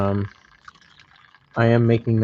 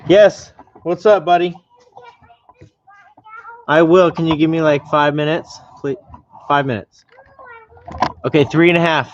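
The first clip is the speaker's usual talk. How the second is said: yelling across the room; 6 semitones higher, 6 dB louder than usual. Real, not acted. Dad calling to child in room.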